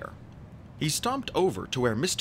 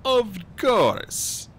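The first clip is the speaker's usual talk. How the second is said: higher, loud oily agreement; put-on smooth compliance; 11 semitones higher, 6 dB louder than usual. A narrator's reading. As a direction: agree smoothly, hiding anger. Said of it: "calm himself and answer smoothly".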